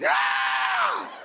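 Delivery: very high, sustained mock scream; waxer's yell. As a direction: let out one long high yell. Imitates waxer's violent yank.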